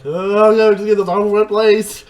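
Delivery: loud, high-pitched wail of dismay; mock exasperated shout. Voicing everyone watching a failed fire challenge, exasperated shouting. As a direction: cry out like a fed-up crowd.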